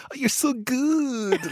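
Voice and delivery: high, drawn-out gushing praise in a mock French accent; exaggerated French-accented fawning, slow and high; put-on French colleague's accent. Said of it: "always puts on this accent".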